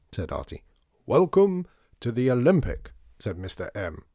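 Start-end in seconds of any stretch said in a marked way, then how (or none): 1.1-2.9 loud, high, grand welcome; speech-making. Acted. Shopkeeper welcoming a visitor, loudly proclaiming. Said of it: "about to make a speech".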